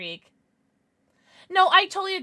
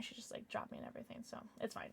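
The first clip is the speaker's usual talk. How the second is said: whispered; 16 dB quieter, faster than usual. Real, not acted. Deflated after venting.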